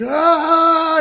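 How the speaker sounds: high, loud hammed-up battle yell; mock war cry. Imitating Theon charging the Night King, a loud mock war cry. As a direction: yell like a doomed charging warrior.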